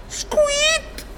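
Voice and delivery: high squeak then baffled protest; very high mouse squeak, then high confused denial; dumbfounded squeak. Acted. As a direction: squeak in pure shock. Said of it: "lo guardavo allibito".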